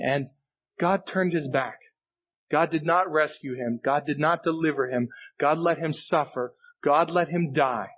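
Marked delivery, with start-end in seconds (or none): none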